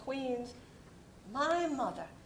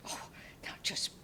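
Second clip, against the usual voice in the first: whisper; 8 dB quieter, faster than usual. Acted. Soothing panicking friend.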